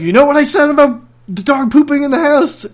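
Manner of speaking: high, loud mock threat; mock threatening mom. Mom threatening the brother about dog poop, loud mock menace. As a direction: threaten like a teasing mother.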